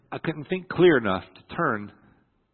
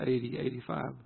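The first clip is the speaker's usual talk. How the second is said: ordinary talk, nothing marked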